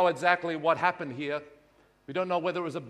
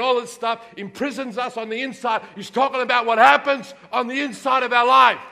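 loud, high, driving preacher's climax; full-force preaching; 6 semitones higher, 11 dB louder than usual. Acted. Pastor preaching hard to the congregation, booming. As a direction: pound it out from the pulpit.

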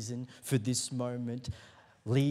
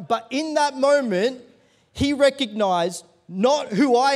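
high, raised preaching voice; emphatic sermon delivery, pitched up; impassioned preaching; 8 semitones higher, 7 dB louder than usual. Real, not acted. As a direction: drive the point home to the congregation. Preacher drives home the point.

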